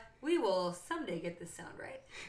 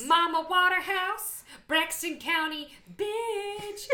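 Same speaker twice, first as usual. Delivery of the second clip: loud, high, swaggering hype; hype-man shout; 6 semitones higher, 5 dB louder, slower than usual. Pretend. Joking about a witch nickname, loud swaggering hype. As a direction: shout it like a rapper's shout-out. Hyping a fake witch persona.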